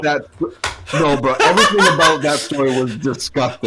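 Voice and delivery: loud, laughing revulsion; laughing disgust. Real, not acted. Co-host recoils from a friend's gross eating story, laughing in disgust. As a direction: protest loudly through laughter.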